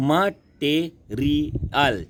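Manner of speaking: Material slow, MA, TE, RI, AL spaced out; syllable by syllable. Shown as a demonstration. Teacher counting four syllables for children, material split slowly. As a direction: chop material into four separate beats. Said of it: "चार है".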